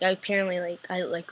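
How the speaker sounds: normal talking voice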